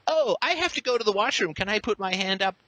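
put-on high whiny kid asking permission; mocking child asking. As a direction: whine it like a timid pupil.

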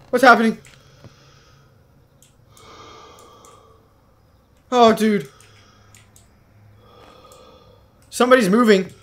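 loud, high panicky calls; alarmed. Real, not acted. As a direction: call out in rising alarm. Panics as something moves in game.

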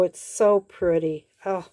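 ordinary talk

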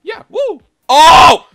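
very high, loud triumphant whoop; excited cheer. Real, not acted. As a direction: cheer like you just won. Cheering at scoreboard rank.

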